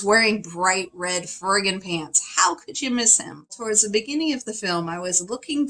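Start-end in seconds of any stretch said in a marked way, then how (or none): none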